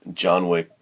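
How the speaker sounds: ordinary talk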